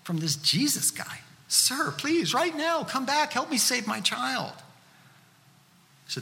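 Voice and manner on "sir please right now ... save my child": much higher pitch, a father begging in panic; desperate pleading. Acted. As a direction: plead urgently for your dying child. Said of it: "He's gotta lower himself to plead for help".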